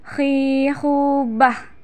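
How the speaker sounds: slow "khihubah" with scraped kh; "khihubah" as a drawn-out throat-sound drill; snore-like kh. Shown as a demonstration. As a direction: stretch "khihubah", rasping the kh. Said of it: "seperti suara orang yang sedang mendekur".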